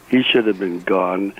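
ordinary delivery

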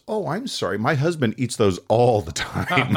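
sweetly insincere sorry; mock-innocent wife. Wife feigning innocent apology.

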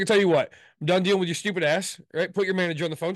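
heated, forceful telling-off; angry demand. Retold or imitated. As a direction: cut the agent off and demand the boss. Retold demand for a manager.

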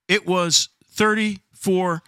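very slow, word-by-word delivery; drawn-out, weighty announcement; slow emphasis. Real, not acted. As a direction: spell it out for dramatic effect. Spelling out how cold it was.

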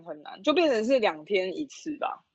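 usual speaking voice

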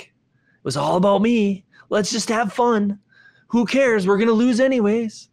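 careless attitude. Retold or imitated. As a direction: toss it off like nothing matters.

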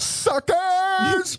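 high taunting jeer; mock taunt. A departing settler taunts those who stay, high and jeering. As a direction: jeer high at the suckers left behind. Mocking the settlers left behind.

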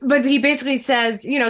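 ordinary delivery

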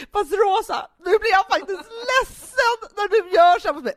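high, loud hurt-mother plea, half laughing; guilt-trip the child like Mom would; sounding like our mother. Retold or imitated. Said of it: "jag är inte Hanna Widell, jag är Stina Stjernberg, vår mamma".

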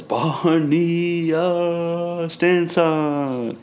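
high announcer voice; high, showy video-game announcement; announcer-style name. Retold or imitated. Quoting Barney's game-style announcement.

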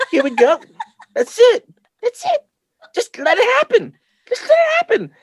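high, laughing coaxing voice; high teasing let-it-happen voice; mock coaxing. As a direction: squeak it out in mock encouragement, laughing.

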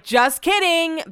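high, drawn-out mock reveal; imitating a joker's gotcha; teasing. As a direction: sing out the prank reveal, loud and slow. Imagines boss revealing a prank.

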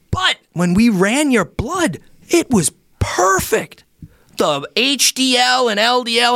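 high, drawn-out mock astonishment; amazed reveal. Red Cross lady reveals the blood results, amazed. Retold nurse marvels at test results.

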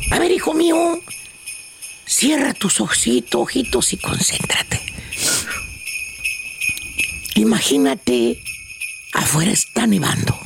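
slow, low meditation voice; guided meditation. Acted. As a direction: lead a calm Christmas visualization slowly. Leads a mock meditation.

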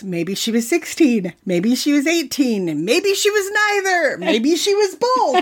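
mounting mock-dramatic wondering; escalating speculation. Acting out Gansey's escalating guesses.

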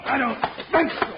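loud high fighting yell; straining shout. Acted. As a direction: grunt it out mid-fight. Fistfight in the dark.

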